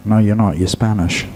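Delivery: ordinary delivery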